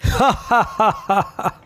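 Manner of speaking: a short rising laugh; Lalu's laugh. A narrator's reading. Lalu laughs at his friends' teasing, short rising laugh. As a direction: laugh lightly before answering. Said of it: "সে হেসে বললে".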